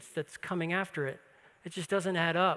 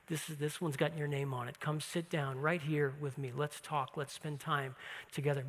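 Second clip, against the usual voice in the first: tender invitation; 5 semitones lower, 8 dB quieter than usual. Retold or imitated. Jesus invites Martha to sit.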